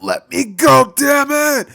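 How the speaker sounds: high, loud furious yell. Acted. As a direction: scream it while struggling free. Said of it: "he would yell out".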